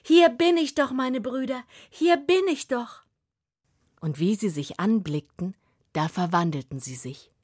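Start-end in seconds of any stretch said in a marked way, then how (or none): 0.0-3.0 put-on much higher, louder girl's voice; girl calling out. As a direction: call out joyfully to the brothers. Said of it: "und sagte".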